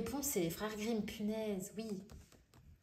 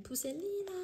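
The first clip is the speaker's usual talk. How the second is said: Pousselina squealed much higher in a new voice; delighted squeal; 11 semitones higher than usual. Real, not acted. Reads a title, then squeals it.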